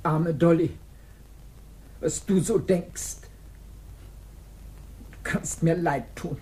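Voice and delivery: low, slow scornful pity; cold pity. Acted. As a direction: pity her with contempt.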